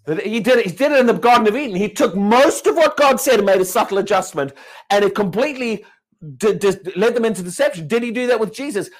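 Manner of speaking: loud, driving sermon; preaching at full force. Acted. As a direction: hammer it home to the congregation.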